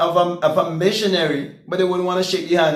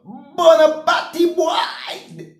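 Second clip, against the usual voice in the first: much higher, very slow drawn-out yell; a high, stretched-out hostile shout; retold street shout; 9 semitones higher, much slower than usual. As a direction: call it out like a hostile man in the road. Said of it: "The men who would be shouting out".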